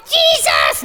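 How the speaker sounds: high-pitched puppet yell; shrill, over-eager shout. Acted. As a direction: shriek it out like an excited crowd. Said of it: "all the people started yelling at him".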